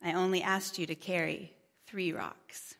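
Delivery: soft, low, tender reply; a quiet, kindly voice of God; very gentle. Acted. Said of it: "and he very gently said".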